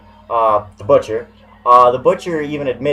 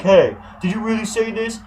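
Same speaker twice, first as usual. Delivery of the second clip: higher, mocking whiny voice; mocking whiny quote; 8 semitones higher than usual. Vlogger mocks a rival's secret messages, whiny sneer. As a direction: sneer it like a gossip. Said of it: "be like".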